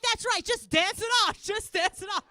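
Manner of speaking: high, loud encouragement; mock cheerleading. Mother urging fallen son to dance, overexcited cheering. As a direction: cheer it on with forced excitement.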